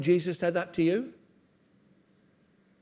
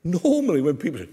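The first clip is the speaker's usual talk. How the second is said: ordinary delivery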